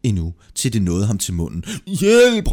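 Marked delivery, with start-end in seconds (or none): 1.9-2.5 high, loud drowning shout; panicked, drawn-out call; cry for help. A narrator's reading. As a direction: yell for help at full pitch. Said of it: "råbte han igen".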